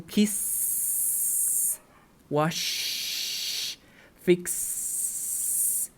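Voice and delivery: kiss, wash, fix, whispered endings without voice; unvoiced endings. Shown as a demonstration. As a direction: breathe the end sounds of kiss, wash, fix. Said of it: "So you say, for example".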